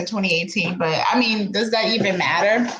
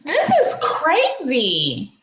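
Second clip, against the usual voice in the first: stunned disbelief; 6 semitones higher, much slower than usual. Real, not acted. Reacting to wild allegations.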